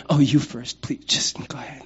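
the preacher mocking the doormat guy, whispering; meek whisper. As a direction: shrink away politely. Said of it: "Not as the guy who went".

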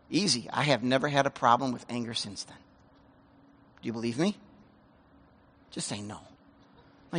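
ordinary delivery

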